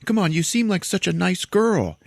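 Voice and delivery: high-pitched imitation of desperate begging; mock pleading. Wounded man begs the woman who shot him, mock pleading.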